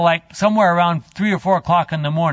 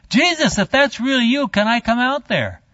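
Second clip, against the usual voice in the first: eager call; 7 semitones higher than usual. Retold or imitated. Peter calls to Jesus on water.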